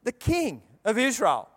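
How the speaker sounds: normal talking voice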